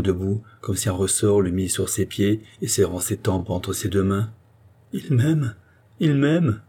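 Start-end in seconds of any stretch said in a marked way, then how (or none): 4.9-6.6 high, emotional repeated exclamation; overwhelmed amazement. A narrator's reading. Andrée hearing Charny's declaration of love, overwhelmed amazement. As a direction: cry it out as a wondering realisation.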